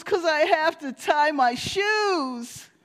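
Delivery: put-on high, slow, whiny child voice; whiny little boy. Preacher voicing a crying son to his mother. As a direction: play the upset little boy.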